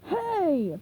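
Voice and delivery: offended seagull; seagull cry. Acted. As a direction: yelp it high. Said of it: "the seagull cried".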